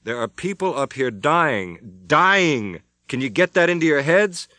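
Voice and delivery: slow, high, loud outburst; desperate. A narrator's reading. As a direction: make them hear it, desperate. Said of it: "his voice shaking slightly".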